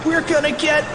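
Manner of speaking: high-pitched wail of terror; hammed-up frantic shriek; panicked cry. A narrator's reading. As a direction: wail it high as disaster nears. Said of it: "cried Fluffy".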